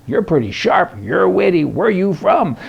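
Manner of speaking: mock manager voice. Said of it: "he says".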